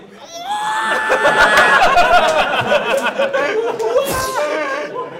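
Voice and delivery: cri aigu et très fort de bête blessée; hurlement de créature. Acted. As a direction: hurle comme un monstre touché à mort. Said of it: "Puis la créature a fait". (hurlement de créature aigu)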